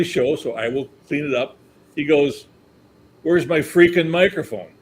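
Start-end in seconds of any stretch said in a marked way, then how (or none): 3.2-4.7 louder, higher mock outburst; annoyed. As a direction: imitate an irritated star demanding an answer. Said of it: "he's not happy".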